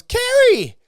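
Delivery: very high, loud yell, falling; shouted name. Retold or imitated. Luke shouting back to Carrie on the hangar floor, a loud high yell. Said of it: "And he goes".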